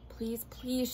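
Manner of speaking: usual speaking voice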